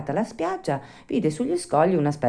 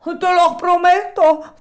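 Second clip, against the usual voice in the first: high, loud, slow pleading promise; desperate promise; 8 dB louder, slower than usual. A narrator's reading. Alidoro promising in a panic, slow and high. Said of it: "A quelle grida strazianti".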